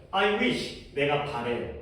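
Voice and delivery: ordinary talk, nothing marked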